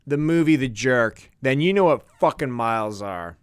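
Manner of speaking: ordinary talk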